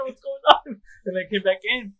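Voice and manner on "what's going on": high bewildered cry; shocked and lost. Retold or imitated. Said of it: "I was like".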